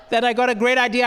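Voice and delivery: ordinary talk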